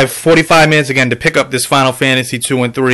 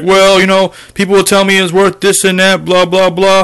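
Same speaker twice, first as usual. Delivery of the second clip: higher, mocking voice for the seller's excuse; 5 semitones higher than usual. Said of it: "He's like".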